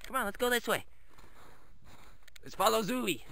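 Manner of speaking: normal talking voice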